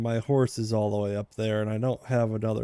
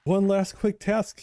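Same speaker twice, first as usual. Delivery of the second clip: higher, slow ironic self-quote; mock quoting himself; 9 semitones higher, slower than usual. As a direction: quote your past self sarcastically. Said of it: "he said".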